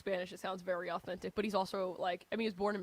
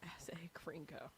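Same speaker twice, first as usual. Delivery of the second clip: whisper; 4 semitones lower, 16 dB quieter, slower than usual. Real, not acted. Whispered echo.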